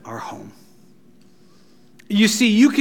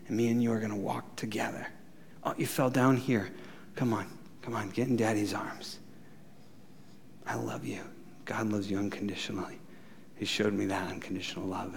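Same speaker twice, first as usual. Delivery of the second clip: much softer, at times whispered; tender father; 8 semitones lower, 6 dB quieter, faster than usual. Retold or imitated. A daddy picking up his fallen child, soft and tender. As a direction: coax a fallen child gently into your arms.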